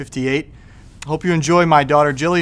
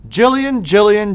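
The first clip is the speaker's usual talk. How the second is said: high sing-song call of a name, a bit louder; mother calling out; 6 semitones higher, 5 dB louder than usual. Acted. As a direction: call her child from down the hall. Mother calls daughter again.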